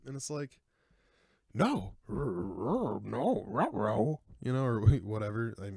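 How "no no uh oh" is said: a rough, louder cartoon-dog whimper of "ruh-roh"; an unpractised Scooby-Doo voice, a bit louder; Scooby-Doo impression. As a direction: whine it like a scared cartoon dog, half-hearted. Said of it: "I should have worked on my Scooby-Doo, uh, impression".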